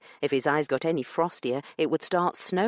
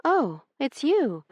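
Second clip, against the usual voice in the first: slow, letdown oh; disappointed; 11 semitones higher, 3 dB louder, slower than usual. A narrator's reading. Io finds her brother instead, disappointed. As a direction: let the hope drain out. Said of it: "Her face fell".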